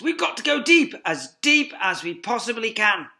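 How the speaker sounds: high, loud command; ordered. Acted. Fox ordering family downwards, loud command. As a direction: bark the order. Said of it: "ordered Mister Fox".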